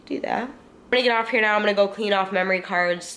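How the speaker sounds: ordinary delivery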